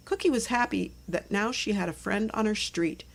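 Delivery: ordinary delivery, nothing marked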